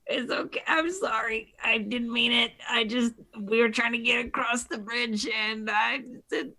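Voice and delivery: high, soft crying voice; tearful apology. Acted. Character crying and hugging, saying she's sorry, high tearful voice. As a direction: sob out an apology in a small, high voice. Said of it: "crying and hugging you and is just upset".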